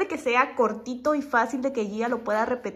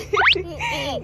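delighted laugh; 5 dB louder than usual. Real, not acted. Delighted at baby's reaction.

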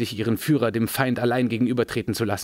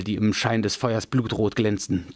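usual speaking voice

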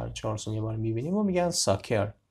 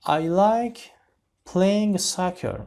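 announced sentence; 6 semitones higher, 5 dB louder, much slower than usual. Shown as a demonstration. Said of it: "teacher announces new sentence".

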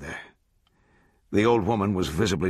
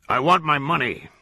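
higher, louder repeated demand; cold demand; 6 semitones higher, 4 dB louder than usual. A narrator's reading. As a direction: press him harder. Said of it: "he said".

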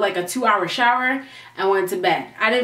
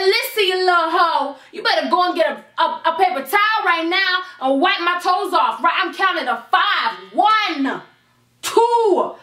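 higher, bossy, laughing threat; 5 semitones higher than usual. Retold or imitated. Drunk narrator ordering the girl to wipe her toes, bossy threat. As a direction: order her around and count her down.

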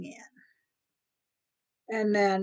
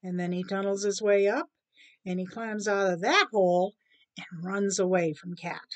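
usual speaking voice

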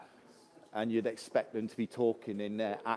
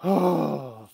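loud exasperated huff; big grumbling sigh; 3 semitones higher, 6 dB louder than usual. Shown as a demonstration. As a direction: huff it out hard, with a frown. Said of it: "frowning and huffing. You're like".

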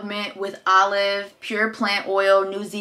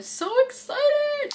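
excited squeal; 3 dB quieter, much slower than usual. Real, not acted. Unboxing gifts, squealing.

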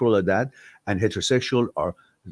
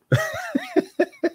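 high laugh. Real, not acted. Laughs at own accent.

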